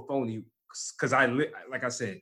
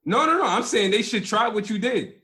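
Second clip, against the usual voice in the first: high protest; 9 semitones higher, 5 dB louder than usual. Real, not acted.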